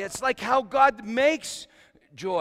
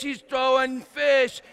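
ordinary delivery

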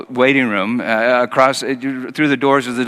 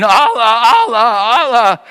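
high, louder wailed Allah; high, louder mock wail; mock screaming Allah; 9 semitones higher, 6 dB louder, slower than usual. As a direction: imitate a labouring woman's cries. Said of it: "woman that kept screaming".

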